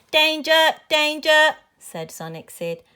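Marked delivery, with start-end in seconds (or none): 0.1-1.6 high, loud alarm call; robot warning. A narrator's reading. Toy robot alerting the children, loud warning.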